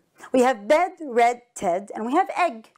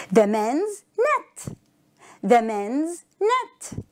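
slow, spaced words; slow model reading; much slower than usual. Shown as a demonstration. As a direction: read it out slowly for learners. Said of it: "Look at the first one".

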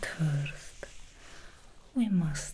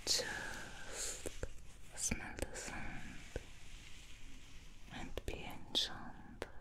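much softer whisper, further from the mic; distant whisper; 14 dB quieter than usual. Acted. Hairdresser stepping away while the bleach works, faint distant whisper. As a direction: drift back from the ear as you whisper.

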